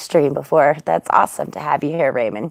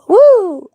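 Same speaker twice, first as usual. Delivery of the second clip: high loud whoop; rock cheer whoop; 5 dB louder than usual. Real, not acted. Streamer whoops after her chorus, a loud high cheer. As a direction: whoop like a concert crowd.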